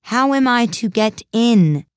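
loud, high, drawn-out demand. A narrator's reading. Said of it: "in a louder tone".

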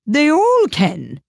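loud, high, very drawn-out character voice; Duchess. A narrator's reading. Duchess answers Alice on grinning cats, loud high character voice. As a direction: declare it slowly, pitched high and loud.

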